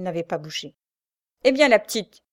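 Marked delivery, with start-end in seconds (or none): 1.4-2.2 raised, louder call to rouse someone; exclaiming. A narrator's reading. Woken prisoner to her chainmate, exclaiming. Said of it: "s'écria sa compagne".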